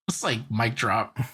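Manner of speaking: ordinary talk, nothing marked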